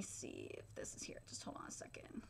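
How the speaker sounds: muttered under breath while checking; whispered aside. Real, not acted. Reviewer whispering to himself while scrubbing through the episode. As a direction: whisper it to yourself.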